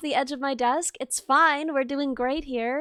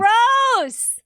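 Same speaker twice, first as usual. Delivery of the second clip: high, loud, drawn-out outcry; loud disgust; 7 semitones higher, 6 dB louder than usual. Real, not acted. Streamer hearing friend needs the toilet, crying out in disgust. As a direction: holler it in playful revulsion.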